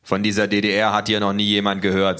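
forceful, loud official's line; lauter Beamter. A narrator's reading. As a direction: speak up like a stern official. An official voiced in a story. (lauter, amtlicher Ton)

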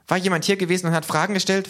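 high, fast, loud questioning; urgent demand. A narrator's reading. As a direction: demand an answer in a rush. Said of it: "verlangte das K zu wissen". (hastig drängend, hohe Stimme)

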